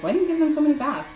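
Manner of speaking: a hammed-up whiny husband; mimicking husband. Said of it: "He's like".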